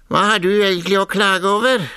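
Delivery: lightly voiced clerk asking a petitioner; questioning clerk. A narrator's reading. Clerk asks the pig about its complaint, questioning.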